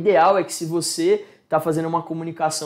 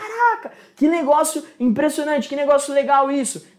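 much higher, excited exclamation; amazed surprise; 7 semitones higher than usual. Shown as a demonstration. Showing how to react to something impressive, high amazed. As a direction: gasp with delight at something impressive. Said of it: "Pro espanto, pra aquela surpresa".

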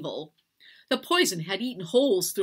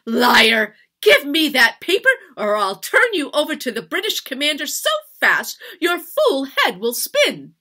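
loud, high furious shouting; shouted, high-pitched tirade; enraged; 9 semitones higher, 12 dB louder than usual. A narrator's reading. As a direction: rage and threaten at full force. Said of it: "Her voice shook with rage".

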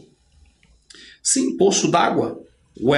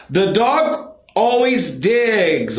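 slow teaching read; 6 semitones higher, much slower than usual. Shown as a demonstration.

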